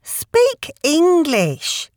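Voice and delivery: scoffing eaglet. Acted. Eaglet scoffs at long words.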